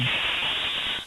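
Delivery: long hissed "s"; drawn-out S sound. Shown as a demonstration. Teacher showing what C before E says, long S sound. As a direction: hold the "s" as one sustained hiss. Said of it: "elle se dit".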